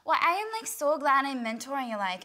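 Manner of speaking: a hammed-up Irish-ish accent from a TV mentor. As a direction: gush like a glamorous mentor in a mock Irish-sounding accent. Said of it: "Her accent is just amazing".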